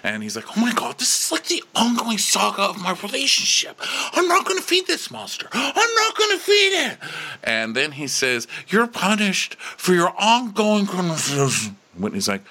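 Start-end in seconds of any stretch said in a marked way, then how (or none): 0.6-7.0 very high, hammed-up wail; imitating a man melting down; mock exasperated outburst. Retold dramatic outburst at Whitney. 8.7-11.8 slow, high-pitched mock indignation; hammed-up aggrieved whine; mock accusing outburst. Retold outburst, acted.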